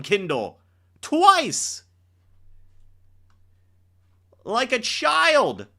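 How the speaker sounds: high, loud, scornful rebuke; mock scolding. Real, not acted. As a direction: shame the veteran for falling. Berating veteran for falling.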